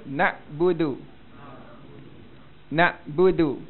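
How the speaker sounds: "Nak budu" with a controlled catch after "Na"; moderate ain. Shown as a demonstration. Teacher leads repetition, moderate ain in "Nak budu". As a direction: measure out the ain in "Nak budu" without straining. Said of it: "cuba sebut".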